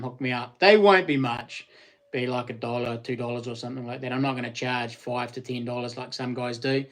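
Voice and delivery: ordinary talk, nothing marked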